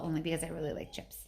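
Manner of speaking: fast private whisper aside. Real, not acted. Reader whispers to the child about the chip, fast. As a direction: breathe it quickly under your breath. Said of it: "unvoiced whisper".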